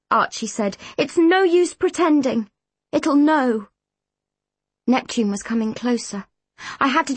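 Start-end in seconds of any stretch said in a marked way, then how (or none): 1.0-3.7 higher-pitched, emphatic insistence; forceful coaching; urging. A narrator's reading. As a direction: press her to mean it. Urging her to mean it.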